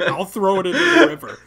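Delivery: hammed-up ultimatum at the standoff; mock threat. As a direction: threaten them, holding the sauce up. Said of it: "I'm like".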